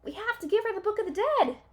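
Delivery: high, loud, fast urgent shout; loud rushed call. A narrator's reading. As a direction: hurry to tell her before she goes. Jack calls after Annie.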